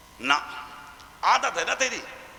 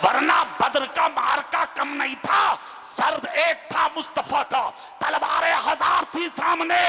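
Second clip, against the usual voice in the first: battle-story preaching; 6 semitones higher than usual. Acted. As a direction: stir them with the battle tale. Preacher recounting Badr battle. (जोशीली तक़रीर)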